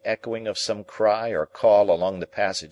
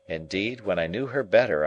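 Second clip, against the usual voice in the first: plain reading voice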